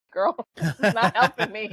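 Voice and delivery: laughing through a frustrated retort; half-laughing, fed up with the unhelpful answer; laughing exasperation. Retold or imitated. To her lost mom on the phone, laughing in disbelief.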